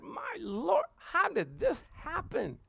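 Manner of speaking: high-pitched, slow amazement; astonished. Retold or imitated. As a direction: marvel in drawn-out disbelief. Amazed at the sum raised.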